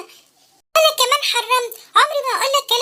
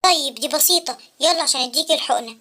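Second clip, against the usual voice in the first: put-on much lower, gentle old man; wise old inventor voice; 11 semitones lower than usual. Uncle Abqarino asking the sad boy why, low. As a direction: ask like a kindly old uncle.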